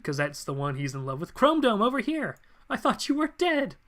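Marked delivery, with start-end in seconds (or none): 1.3-3.8 high-pitched dramatic call; acted astonished cry. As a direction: call over urgently, pitched well up. Acted calling out to a loved one.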